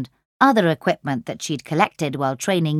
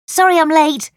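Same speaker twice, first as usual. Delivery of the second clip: high, loud call to friends; 10 semitones higher, 5 dB louder than usual. Acted. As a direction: call out cheerfully as you run up. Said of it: "she called".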